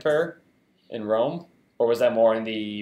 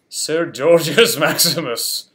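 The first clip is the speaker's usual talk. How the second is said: grand Roman title; 2 semitones higher, 6 dB louder, slower than usual. Pretend.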